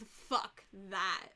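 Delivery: ordinary delivery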